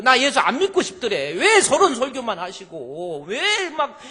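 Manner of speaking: loud, high exasperated outburst; conscience-stricken complaint. Retold or imitated. Churchgoer stung by the sermon, loud exasperated complaint. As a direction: whine loudly in mock exasperation. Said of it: "양심의 가책을 느껴가지고".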